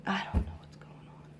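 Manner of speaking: hushed whisper, nearly no voice; whispered aside. Real, not acted. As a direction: breathe it out as a worn-out aside. Tired mother aside, unvoiced.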